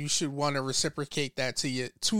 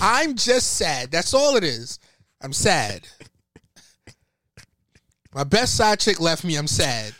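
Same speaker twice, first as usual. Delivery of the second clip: mock sad; 4 dB louder than usual. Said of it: "I was just making a funny".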